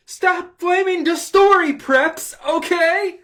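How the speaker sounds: high, loud, slow mock yell; all-caps shout. Reading the author's all-caps note as a mock yell.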